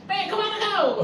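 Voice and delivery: mock a blunt Batak shout-out; harsh Batak tone. Preacher imitating a Batak man's blunt tone. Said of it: "Nadanya orang Batak ... keras sekali".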